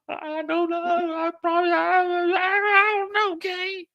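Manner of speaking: very high, trembling mock female voice; a frightened girl stalling; squeaky scared woman's voice, slow.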